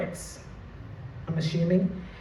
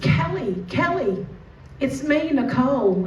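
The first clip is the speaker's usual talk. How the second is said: put-on high, eager calling as if to an old school friend; feigned recognition, pitched up; put-on friendly greeting; 5 semitones higher than usual. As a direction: act delighted to see her. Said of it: "so proud of me for my acting".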